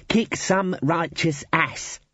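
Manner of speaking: put-on patiently correcting young wizard. Ponder quoting the Dean.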